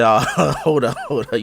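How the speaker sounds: half-laughing hold on; laughing disbelief. Retold or imitated. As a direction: laugh while stopping the cashier. Questioning the cashier, laughing.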